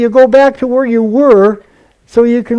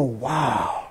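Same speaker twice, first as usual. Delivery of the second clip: soft, low, drawn-in wonder; awed hush; 7 semitones lower, 12 dB quieter than usual. Acted. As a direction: breathe out a quiet, amazed wow. Acting out awe at scripture.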